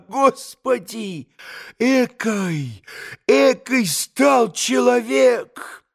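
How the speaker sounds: high, slow cry of shame; drawn-out dismayed outburst; mortified exclamation. A narrator's reading. Grushenka despairs at what he's become, high drawn-out cry. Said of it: "воскликнула Грушенька ... покраснела от стыда".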